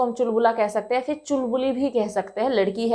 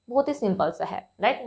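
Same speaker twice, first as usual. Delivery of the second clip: normal talking voice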